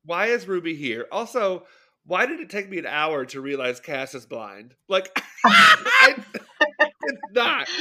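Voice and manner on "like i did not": high squealing laugh. Real, not acted. Laughing at realizing the character is blind, squealing.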